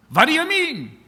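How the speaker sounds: put-on high, loud, offended old man with a Yiddish accent; lightly voiced old Izzy balking at the doctor; indignant old man.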